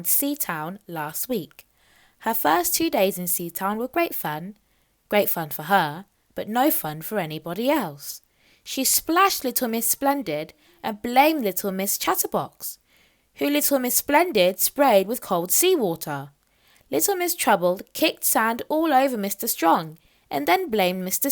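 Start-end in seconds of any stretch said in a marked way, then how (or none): none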